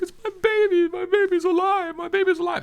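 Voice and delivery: very high, loud mock wail; imitated happy crying. Husband explaining tears to his wife, imitated happy crying. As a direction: blubber it hammed-up, squealing with joy. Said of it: "Why are you crying about lunch?".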